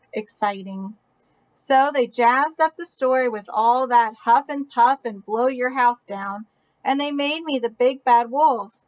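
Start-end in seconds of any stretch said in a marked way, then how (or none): none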